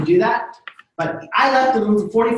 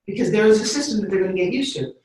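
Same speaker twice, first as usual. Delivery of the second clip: ordinary talk, nothing marked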